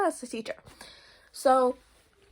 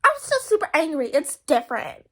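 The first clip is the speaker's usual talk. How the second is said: hammed-up sulking friend; angry friend; 2 semitones higher, 6 dB louder than usual. Friend insisting she is still angry, in a loud mock huff. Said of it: "she's like".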